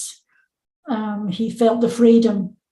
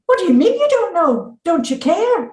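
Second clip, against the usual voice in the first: higher, pressing demand; indignant daughter; 7 semitones higher, 3 dB louder than usual. Retold or imitated. Daughter demanding answers from mum, raised and indignant. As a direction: push back hard on the answer. Daughter challenges her mother.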